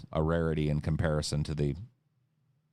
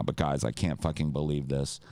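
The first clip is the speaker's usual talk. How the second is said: ordinary talk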